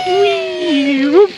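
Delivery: drawn-out high whee; excited whee. Real, not acted. Baby going down slide.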